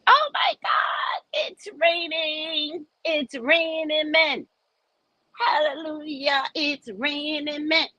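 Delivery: high, slow breathless joy singing out the rain; swooning with delight at the rain; elated rapture. Retold or imitated. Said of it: "I was elated".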